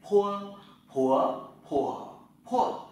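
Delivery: "pua" with a strong puff of air, lips popping open, loud; aspirated pua drill. Shown as a demonstration. Teacher showing students how to aspirate "pua". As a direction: push the air out on "pua" through four tones. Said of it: "Thử lại nhé".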